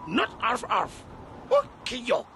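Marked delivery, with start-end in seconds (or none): 1.5-2.2 Ukiok with the weight on the first syllable uk; Ukiok, uk stressed. Shown as a demonstration. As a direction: lean on the uk in Ukiok. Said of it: "The accent is in the uk".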